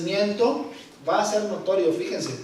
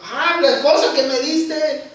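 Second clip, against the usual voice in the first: Adam blaming; 6 semitones higher, 7 dB louder than usual. Retold or imitated. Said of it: "le dice Adán".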